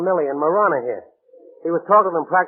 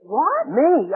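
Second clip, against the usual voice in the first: sharp high startled "What?"; jolted, caught off guard; shocked disbelief; 9 semitones higher than usual. Acted. Accused of faking Jaru's voice.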